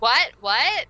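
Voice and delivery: startled what. Retold or imitated. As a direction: bark it back as if caught off guard. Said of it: "and she's like".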